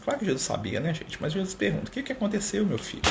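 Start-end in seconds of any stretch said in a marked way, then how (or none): 1.9-3.0 tender softer question. Retold or imitated. Jesus asks tenderly.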